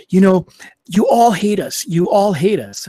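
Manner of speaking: loud, high mock accusation; imitating her angry accusation. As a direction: throw the accusation like she did, half-hammed. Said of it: "she just got really angry with me".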